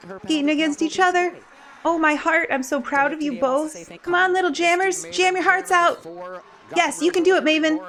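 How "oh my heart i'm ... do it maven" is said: high, doting encouragement; root for the little ones like a proud aunt; proud cheering. Real, not acted. Cheering kids in first game.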